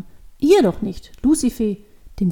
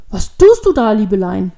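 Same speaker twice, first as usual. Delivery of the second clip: startled alarm; 10 dB louder than usual. A narrator's reading. Old man sees her tugging her hair, startled. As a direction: cry out in shock to stop a child. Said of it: "fragte der alte Mann erschrocken".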